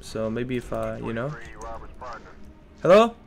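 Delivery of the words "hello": loud, higher calling out. Real, not acted. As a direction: yell it out for help. Calling out for backup.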